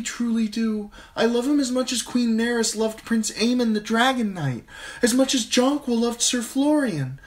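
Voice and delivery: put-on high, eager young girl pleading; a starry-eyed girl begging her father; girlish Sansa voice. A narrator's reading. Daughter to her father, high and eager.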